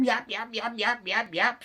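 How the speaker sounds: high, quick little yaps; yapping dog. Acted. Little dog Harry yaps in reply, high. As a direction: yap like a small dog. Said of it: "said Harry McCleary".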